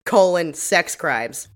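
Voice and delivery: ordinary talk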